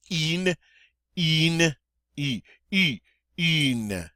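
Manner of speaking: "in" as French "une", rounded "ee". Shown as a demonstration. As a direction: pucker and say "een" for "in". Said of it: "long E sound in English through rounded lips".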